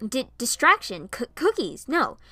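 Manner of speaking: loud, high, upset denial; cried out. A narrator's reading. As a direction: protest loudly in disbelief. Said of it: "I cried out".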